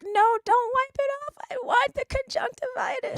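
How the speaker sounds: put-on high pleading child voice; imitating her small son begging; whiny little kid. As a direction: beg like a stubborn toddler. Sister quotes small son Ethan.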